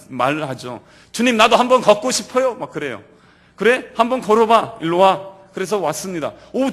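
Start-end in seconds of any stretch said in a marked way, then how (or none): 1.1-2.5 higher, louder eager plea as Peter; a loud, eager ask from Peter; eager request. Acted. Peter eagerly asks to walk. 3.6-5.4 higher, louder inviting call; Jesus calling Peter out onto the waves, high and loud. Acted.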